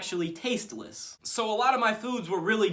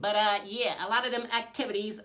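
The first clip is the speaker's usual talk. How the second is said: troll voice; 4 semitones higher than usual. Acted. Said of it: "I really talk like this".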